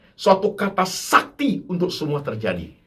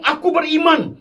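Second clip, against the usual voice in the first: retold pastor; 10 semitones higher than usual. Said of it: "pendetanya ngomong".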